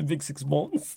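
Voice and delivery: put-on teasing French accent; mock French accent. Co-host teases his friend who prefers French, mock French accent. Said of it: "I prefer the French accent".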